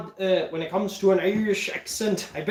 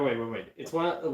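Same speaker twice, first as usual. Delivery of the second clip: ordinary delivery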